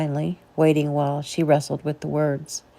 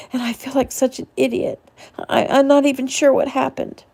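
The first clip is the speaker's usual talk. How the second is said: high, broken, on the edge of tears; upset wife blaming herself, tearful; tearful between hiccups; 7 semitones higher, 4 dB louder than usual. A narrator's reading. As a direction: push through the sobs. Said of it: "muttering the next sentences between hiccups".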